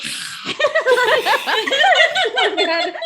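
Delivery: long, high, loud burst of laughter; big laugh. Real, not acted. Player recalling a silly spatula noise, cracking up. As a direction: crack up loudly at the memory.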